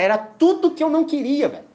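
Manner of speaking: high and loud, exasperated; exasperated outburst. Real, not acted. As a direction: burst out at the worst news. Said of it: "dread of being at the door".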